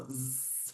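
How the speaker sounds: very soft, low buzzed "Z"; sustained bee-like "Z"; prolonged Z. Shown as a demonstration. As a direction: stretch "Z" into zzz. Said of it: "dá pra criança prolongar o som".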